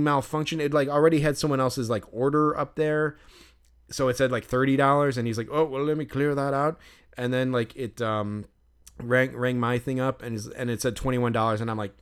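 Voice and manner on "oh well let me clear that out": imitated Irish attendant; thick Irish accent. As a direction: mimic an Irishman offering to sort it out. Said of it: "this thick Irish accent".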